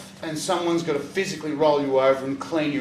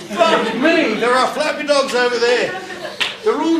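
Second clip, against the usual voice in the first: high, loud astonished cry; drugged amazement; 9 semitones higher, 7 dB louder than usual. Retold or imitated. Spotting the dogs from his bed, high amazed cry. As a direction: gasp it out in high wonder.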